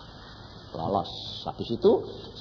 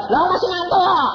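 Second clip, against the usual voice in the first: groggy protest; 9 dB louder than usual. Retold or imitated. Sleepy self retold.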